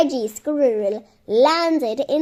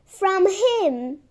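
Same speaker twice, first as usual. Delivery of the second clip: ordinary delivery, nothing marked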